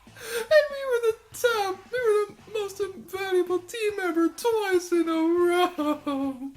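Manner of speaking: high, laughing, tearful shrieks of joy; shrieking jackpot winner. Real, not acted. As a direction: sob and squeal with delight. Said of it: "I gotta admit, I'm tearing up".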